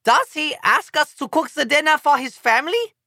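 put-on a German delegate asking, accented; German lady. A narrator's reading. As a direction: quizzical German woman's accent. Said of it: "asks a German lady".

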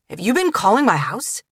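sharp, pointed question; demanding. Acted. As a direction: demand an answer over the phone. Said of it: "Michael demanded".

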